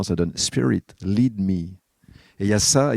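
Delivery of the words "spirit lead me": slow, self-mocking English with a French accent; French speaker's playful English accent. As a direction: say it in English with a put-on accent, slowly. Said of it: "avec mon super accent".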